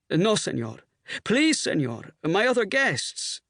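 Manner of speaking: hotel maitre d' begging the rowdy pilots; pleading maitre d'. A narrator's reading. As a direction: beg the colonel for help. Maitre d' begs again.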